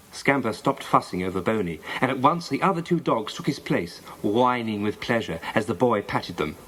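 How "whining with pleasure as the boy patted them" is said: ordinary delivery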